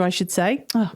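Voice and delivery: usual speaking voice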